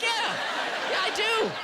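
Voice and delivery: hammed-up excited yell; mock eager yes. Mock eager reply to ads.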